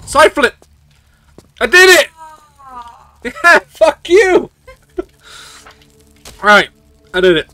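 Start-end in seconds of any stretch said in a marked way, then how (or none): 0.1-2.1 excited. Real, not acted. Pulls off a hard move. 3.2-4.5 gloating. Real, not acted. Gloats at the game after succeeding.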